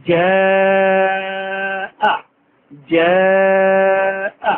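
জাআ held very long; four-alif stretch. Shown as a demonstration. Teacher demonstrating the long madd on জাআ. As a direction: pull the vowel of জাআ out fully. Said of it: "এখন চার আলিফ হলো।".

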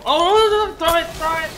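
high shouted urging; frantic shout. Real, not acted. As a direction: yell at them to throw. Urging teammate in fight.

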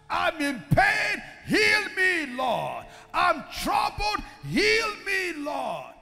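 preacher models a desperate prayer for healing; crying out to God. Shown as a demonstration. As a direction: show them how to cry out to the Lord. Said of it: "Cry out".

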